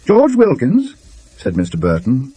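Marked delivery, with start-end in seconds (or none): 0.1-1.0 sharp, much higher startled question; excited. A narrator's reading. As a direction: blurt the name out in alarm, pitched high and louder. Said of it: "more than a little excitedly".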